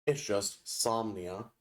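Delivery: drawn-out spell word, slow; slow incantation. Shown as a demonstration. Explaining the spell name, said slowly. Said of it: "the incantation is".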